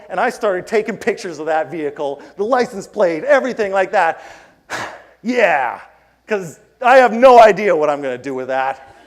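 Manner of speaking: ordinary talk, nothing marked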